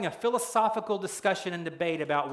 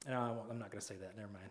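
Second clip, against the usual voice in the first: muttered aside; 10 semitones lower, 16 dB quieter, much faster than usual. Real, not acted. Preacher stops himself mid-thought.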